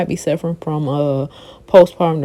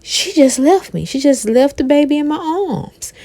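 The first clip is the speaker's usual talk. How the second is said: mimicking Mama D; 8 semitones higher than usual. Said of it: "she was like".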